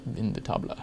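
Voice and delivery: badly faked French, tossed off quietly; silly French accent. Host who speaks no French reading a wine label, silly French accent. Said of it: "doing that stupid accent".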